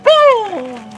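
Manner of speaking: explosion boom. Acted. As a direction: shout the blast. Dynamite explosion.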